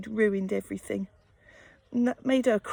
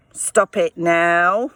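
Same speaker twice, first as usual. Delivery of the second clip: put-on low, slow and firm, a mum stopping a child; stern telling-off; 7 semitones lower, 6 dB louder, slower than usual. Mum to sick toddler, a low slow stern command. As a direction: put your foot down, slowly.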